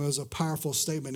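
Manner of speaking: ordinary talk, nothing marked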